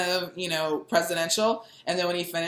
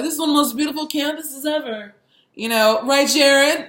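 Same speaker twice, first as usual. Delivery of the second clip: Trump impression; 6 semitones higher, 5 dB louder than usual. Impersonating Trump again.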